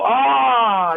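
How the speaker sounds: high loud crowd "Ah"; imitated crowd roar. Imitating the Japanese crowd's roar. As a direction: cheer like a whole arena. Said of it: "they go".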